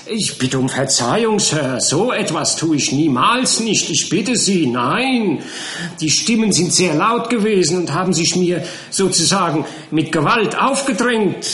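high, loud flustered protest; indignant charwoman. A narrator's reading. The charwoman protests she never eavesdrops, high and flustered. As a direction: protest your innocence in a fluster.

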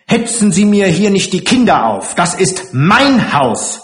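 high, forceful outburst; sudden angry shouting. Acted. Matron claims her house before intruders, shouting angrily. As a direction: shout it, slowing to hammer the claim. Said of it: "schrie sie plötzlich laut".

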